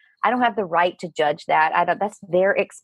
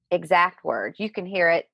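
ordinary talk, nothing marked

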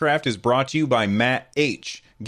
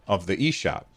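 ordinary delivery

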